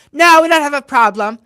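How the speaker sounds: loud, high imitation of the heckler; mock defiant retort. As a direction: snap it back in a mocking put-on voice.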